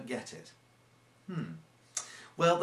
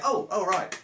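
sudden realisation; 7 semitones higher, 6 dB louder than usual. Retold or imitated. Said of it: "And they go".

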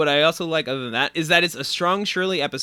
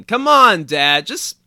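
mock tempter; 6 dB louder than usual. Voicing Gina Gershon pushing cocaine.

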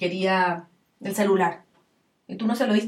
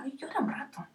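tender comfort. Retold or imitated. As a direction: coax gently, allow the tears. Parent comforting a crying child.

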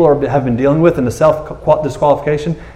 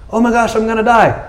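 higher, hammed-up panicked squeal; mock panic; 7 semitones higher than usual. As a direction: play a terrified man, half joking. Gideon panics after sacrifice.

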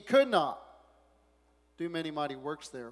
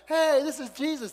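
higher, brighter hello; mock friendly greeting; 10 semitones higher, 9 dB louder than usual. Townspeople hailing Jesus, mock cheerful. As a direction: greet him as a celebrity. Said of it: "They're not saying".

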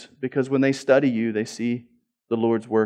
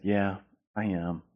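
sheepish admission; 5 semitones lower, 8 dB quieter, slower than usual. Retold or imitated. Owns up to God's rebuke.

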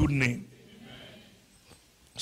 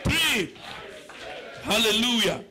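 high, loud declaration over the congregation; fervent preaching prayer; 7 semitones higher, 6 dB louder than usual. Real, not acted. Preacher blessing the congregation's work, fervent shouted prayer. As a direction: pray at full force, voice raised high and loud.

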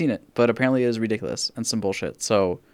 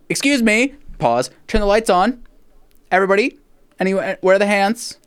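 higher, louder mock official announcement; mock convention staffer; 8 semitones higher, 4 dB louder than usual. Staffer halts the porn screening for hand check, mock-official. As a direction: play the organizer stopping the screening. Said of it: "where they're like".

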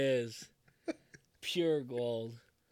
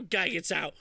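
ordinary talk

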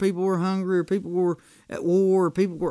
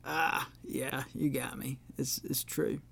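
quiet concession; 5 semitones lower, 13 dB quieter than usual. Retold or imitated. Conceding to friend's advice.

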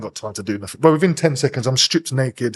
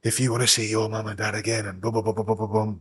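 retold whisper; 4 semitones lower, 7 dB quieter than usual. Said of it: "whispering in my ear".

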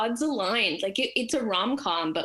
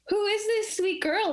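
high-pitched doting pet-owner voice; acted-out gushing sweet talk; cooing at a dog; 11 semitones higher than usual. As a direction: coo it like greeting an adorable dog.

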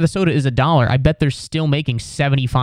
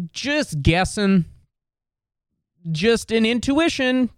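coy innocent reply; 8 semitones higher than usual. Pretend. Coy answer to the staff.

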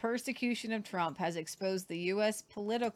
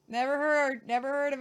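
higher-pitched feigned ignorance; sarcastic; 4 semitones higher, 5 dB louder than usual. Real, not acted. Co-host pretending it is all news, sarcastic. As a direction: play innocent with obvious sarcasm. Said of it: "That's sarcasm".

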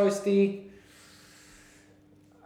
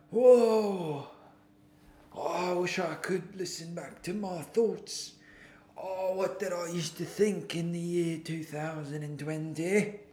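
put-on old self voice; 3 dB quieter than usual. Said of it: "I'm gonna be like".

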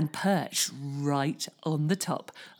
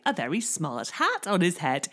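ordinary delivery, nothing marked

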